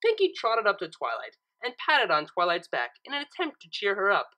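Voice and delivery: ordinary delivery, nothing marked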